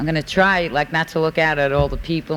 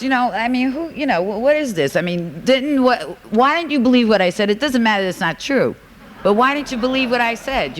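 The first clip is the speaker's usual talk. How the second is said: indignant; 7 semitones higher than usual. Retold or imitated. Voicing her angry denial.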